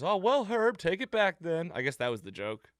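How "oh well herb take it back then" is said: high, louder hammed-up backpedal; imitating an awkward trick gone wrong; mock sheepish magician. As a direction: brush it off in a pitched-up comic voice. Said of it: "He goes".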